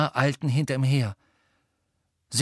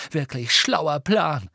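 slow, higher sarcastic jab; angry sarcasm; 5 semitones higher, 4 dB louder, slower than usual. A narrator's reading. Harry venting his rage at Hermine, slow angry sarcasm. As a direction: drag it out with angry scorn. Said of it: "um ein wenig von seiner Wut loszuwerden".

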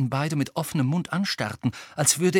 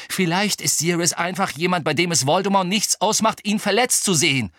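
higher, heated argument; hurled retort; 7 semitones higher, 6 dB louder than usual. A narrator's reading. Harry argues Sirius is expendable to Voldemort, heatedly. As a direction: drive the point at her forcefully. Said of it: "schleuderte ihr Harry entgegen".

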